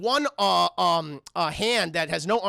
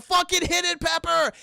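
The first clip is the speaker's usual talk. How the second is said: high, loud desperate order; yelled order; 9 semitones higher, 4 dB louder than usual. Retold or imitated. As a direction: yell at Pepper to do it. Tony yelling order.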